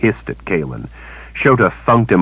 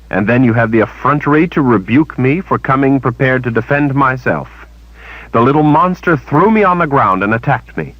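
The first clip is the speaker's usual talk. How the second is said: higher, indignant accusation; Kalen, accusing; 9 semitones higher, 3 dB louder than usual. A narrator's reading. Kalen accusing Shota over the attack, higher and angry. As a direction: hurl the charge back at her.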